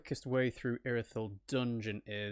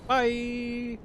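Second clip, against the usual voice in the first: gloating bye; 12 semitones higher than usual. Real, not acted. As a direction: wave the beaten enemy off. Enemy defeated, gloating.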